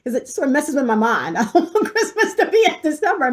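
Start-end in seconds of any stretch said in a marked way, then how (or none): 1.4-3.3 high voice bubbling with laughter; laughing protest. Real, not acted. Laughing at her own rant.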